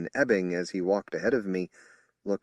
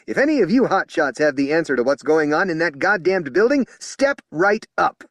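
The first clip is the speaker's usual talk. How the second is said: angry challenge; 10 semitones higher, 9 dB louder than usual. Acted. Spokesman angrily daring the officers to answer. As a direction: challenge the hotshots. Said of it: "Anderson said angrily".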